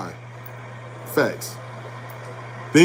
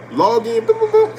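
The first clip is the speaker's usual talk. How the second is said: mock login beeps; 3 dB louder than usual. Acting out logging in at bank.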